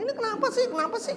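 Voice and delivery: high, exasperated mock whine; exasperated complaint. Boss imagining calling the secretary, high exasperated mock complaint. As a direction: ask why, fed up. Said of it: "sebentar telepon".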